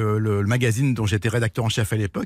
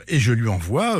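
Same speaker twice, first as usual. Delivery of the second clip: normal talking voice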